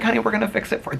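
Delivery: high-pitched imitation of a comforting flight attendant; sweet, soothing. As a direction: soothe a sobbing passenger in a high voice. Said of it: "They were so sweet to me".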